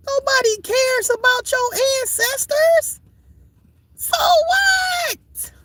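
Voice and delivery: very high yelling; shrill shout. Acted. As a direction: yell it shrill at the crowd.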